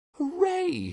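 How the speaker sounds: high, gleeful whoop; excited cry. Acted. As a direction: leap up and shout for joy. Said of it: "he cried".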